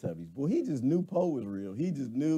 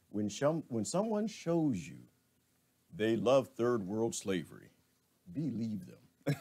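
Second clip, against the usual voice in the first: quoting Maya Angelou; 3 semitones lower than usual. Retold or imitated. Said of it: "Was it Maya Angelou who said".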